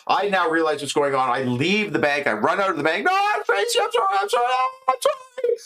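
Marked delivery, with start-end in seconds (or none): 3.0-5.6 very high, panicky squeak; high frantic falsetto plea; panicked falsetto. Retold or imitated. Man apologizing in falsetto panic.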